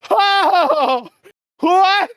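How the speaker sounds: high, loud, stunned laughing "Oh, what?"; shocked laugh. Retold or imitated. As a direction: burst out in disbelief. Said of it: "I was just like".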